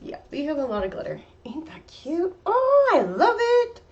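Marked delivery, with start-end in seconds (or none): 1.4-2.3 whispered. Real, not acted. Cooing over the finished painting. 2.4-3.8 high, slow, loud delight; delighted squeal. Real, not acted. Delighted by the finished painting.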